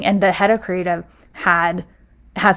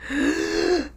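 gasp; 9 semitones higher, 7 dB quieter than usual. Retold or imitated. Elevator doors open on HR.

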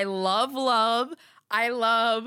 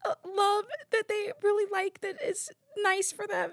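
higher, sugary, near-teary wishful voice; sappy inner voice; 11 semitones higher than usual. Retold or imitated. Host voicing her own sappy thought about love, high and teary. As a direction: voice the gooey hopeful thought like a dreamy child. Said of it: "And sometimes I think".

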